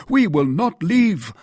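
high, loud refusal; exclaimed protest. A narrator's reading. Porthos objects to D'Artagnan's prudence, crying out. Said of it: "cried Porthos".

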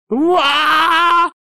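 very high squealed yelp of pain; high-pitched shriek. A narrator's reading. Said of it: "заверещал Вульфинг". (пронзительный визг)

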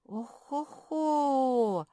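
long, high, loud acted moan; drawn-out "oh-ho-ho" of woe; groaning exclamation. A narrator's reading. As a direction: let the last syllable wail and fall. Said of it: "пробормотала Наретта".

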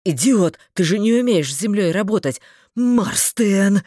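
loud alarmed shout; yelling. A narrator's reading. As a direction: yell at a reckless friend. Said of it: "завопил Дарвальд".

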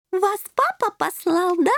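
put-on high little-girl princess voice, slowed, insistent; persistent little princess. A narrator's reading. Shelli pestering the kittens for the twentieth time, persistent high voice. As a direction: pester in a high-pitched girlish voice. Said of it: "не унималась Шелли".

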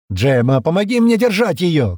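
loud, high urgent call; shouting. A narrator's reading. Стэн calls Джема to hold Шира down, urgent shout. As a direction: yell for help at once. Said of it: "крикнул Стэн".

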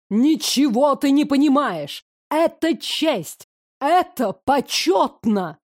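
настаивать пафосно, высоко, с нажимом; stepmother insisting. Acted. (мачеха с пафосным нажимом)

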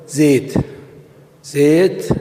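زيت with the vowel between a and i, zeyt; imala. Shown as a demonstration. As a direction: bend the a of زيت toward i, zeyt. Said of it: "يجعلون فتحة الزاي بين الفتحة والكسرة".

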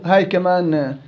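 ordinary talk, nothing marked

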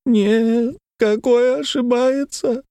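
high, slow tearful whine; high plaintive lament. A narrator's reading. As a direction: wail it as a weeping woman. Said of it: "заговорила слезливым голосом женщина".

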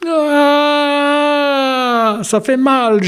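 wolf moaning in pain. A narrator's reading. Wolf at the dentist, high pained moan. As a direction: cry out as the teeth come out. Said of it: "gémit le loup".

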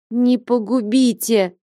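a wailing, drawn-out plea; moaning plea. A narrator's reading. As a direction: beg for mercy on his knees. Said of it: "простонал Алексей".

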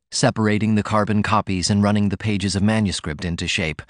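plain reading voice

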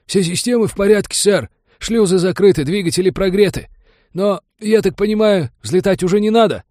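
very high, loud subordinate's voice; flustered report. A narrator's reading. Pirate reports to the new admiral, flustered and high-pitched. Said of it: "принялся сбивчиво докладывать".

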